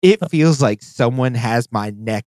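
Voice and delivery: ordinary delivery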